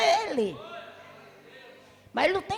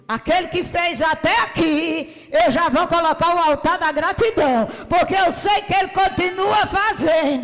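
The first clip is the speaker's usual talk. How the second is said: preaching at full force; 3 semitones higher, 4 dB louder than usual. Real, not acted. Preaching climax.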